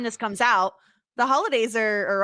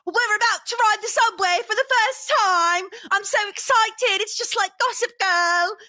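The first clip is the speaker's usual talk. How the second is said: higher, louder excited British tourist; mock excited Brit on the subway; 10 semitones higher, 3 dB louder than usual. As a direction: gush like a giddy tourist. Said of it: "he's like".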